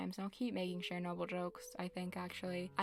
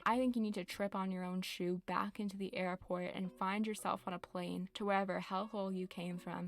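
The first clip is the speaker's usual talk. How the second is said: normal talking voice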